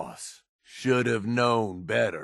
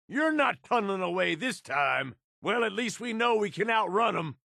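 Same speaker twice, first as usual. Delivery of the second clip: fast shout; 7 semitones higher than usual. Acted. Fleeing enemies, shouting.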